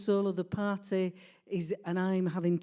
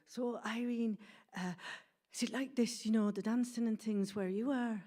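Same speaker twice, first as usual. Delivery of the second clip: soft, higher-pitched careful asking; hushed, hesitant curiosity; tentative question; 4 semitones higher, 5 dB quieter than usual. Retold or imitated. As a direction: ask it delicately, softly and a little high. Cousin's tentative question acted out.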